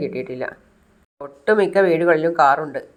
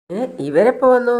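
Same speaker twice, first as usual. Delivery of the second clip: sudden high startled question; louder, higher surprised gasp of a question; startled surprise; 7 semitones higher, 4 dB louder than usual. Retold or imitated. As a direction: caught off guard, voice jumping up. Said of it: "Chandra പറയാണ്".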